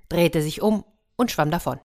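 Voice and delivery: ordinary delivery, nothing marked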